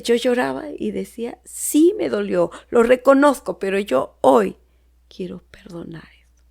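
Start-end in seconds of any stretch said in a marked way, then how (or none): 1.4-4.6 rising, louder tearful plea; retelling a tearful prayer, voice rising. Retold or imitated. Crying while praying to God. 5.1-6.4 breathy whisper of surrender; whispered vow to forgive; whispered resolve. Retold or imitated. End of tearful prayer.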